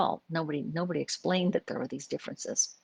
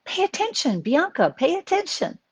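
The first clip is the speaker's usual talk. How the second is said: high, loud mother's warning cry; urgent high-pitched call; alarmed parental warning; 11 semitones higher, 5 dB louder than usual. Retold or imitated. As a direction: call out sharply to a straying child. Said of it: "I'd say".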